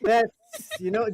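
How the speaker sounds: ordinary talk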